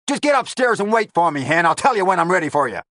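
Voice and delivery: loud high gangster bark; angry gangster. A narrator's reading. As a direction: bark at her to shut up. Lavelle orders her off.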